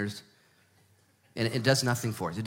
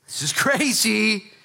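slow, higher, breaking into a laugh on "crazy"; laughing disbelief; 7 semitones higher, slower than usual. Pretend. A believer laughing at his own hard past, higher and slow.

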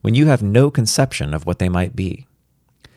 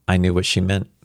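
ordinary delivery, nothing marked

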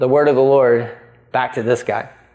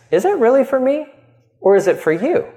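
higher, probing question; God questioning; 10 semitones higher than usual. Retold or imitated. Pastor voicing God asking the church about motives. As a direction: press them gently on motives. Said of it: "He has every right to ask us".

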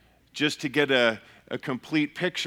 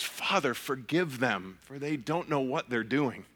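hushed, softer reverent reading; soft reverent quote; 7 dB quieter than usual. Retold or imitated. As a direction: quote the prayer softly, with reverence. Quoting Jesus' prayer on cross.

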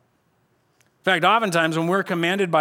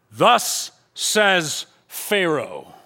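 proclamation; much slower than usual. Retold or imitated. Taskmasters proclaim Pharaoh's words.

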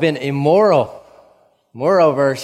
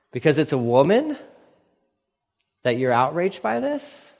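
ordinary delivery

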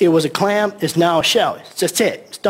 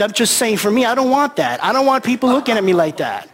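usual speaking voice

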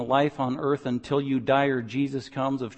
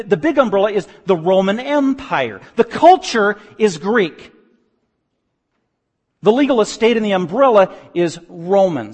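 louder preaching, stretched out on Roman; forceful preaching; 5 semitones higher, 7 dB louder than usual. Acted. Pastor explaining crucifixion to his flock, loud preaching. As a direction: drive home the Roman citizens' pride.

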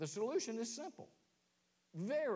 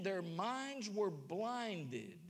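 very slow, every word weighed; slow emphatic scripture; much slower than usual. Acted. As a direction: spell it out slowly to the church. Quoting scripture slowly for weight.

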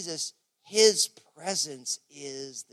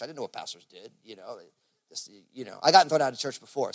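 ordinary delivery

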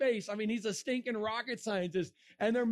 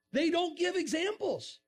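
high-pitched exclaimed complaint; exasperated punchline; 7 semitones higher than usual. Real, not acted. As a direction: burst out in disbelief, voice pitched up. Exasperated punchline about commenters.

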